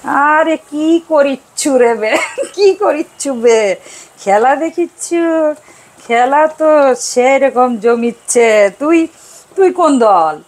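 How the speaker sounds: put-on lively Bogura accent on the phone; Bogura dialect. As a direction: imitate husband chatting with a friend in Bogura speech. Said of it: "ঢাকার ভাষায় কথা বলেন না".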